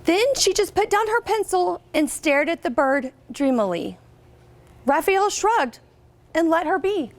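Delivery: storybook reading voice, lively and clear; picture book read-aloud; reading to children. Acted. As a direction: read it out to a class of third graders.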